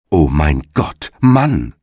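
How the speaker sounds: ordinary delivery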